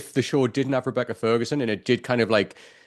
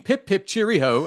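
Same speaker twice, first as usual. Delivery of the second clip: high, hammed-up British accent; mock British accent; 9 semitones higher than usual. Podcaster exaggerating the actress's accent slip, mock British. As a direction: do a cartoonishly cheery British accent. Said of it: "And then she's like".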